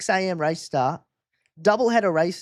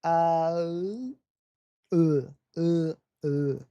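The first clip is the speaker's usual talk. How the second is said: trying out German vowel sounds; German accent; much slower than usual. Shown as a demonstration. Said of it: "I'm trying my German accent".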